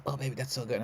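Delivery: soft, tiny little bedroom murmur; soft mock murmur like a shy little mouse; quiet like a mouse. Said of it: "like a little mouse".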